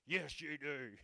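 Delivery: a loud insistent calling voice; voice of God. Retold or imitated. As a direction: proclaim it like a voice from above. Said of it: "Something would go".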